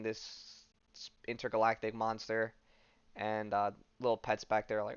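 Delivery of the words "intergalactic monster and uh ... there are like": ordinary talk, nothing marked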